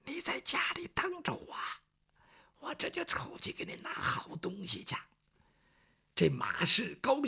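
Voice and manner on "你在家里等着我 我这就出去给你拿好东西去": hushed whisper to his wife, no voice at all. Acted. Niu Lüzi whispering to his wife before sneaking out: whisper. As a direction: breathe it so nobody else hears. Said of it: "轻轻地跟他说".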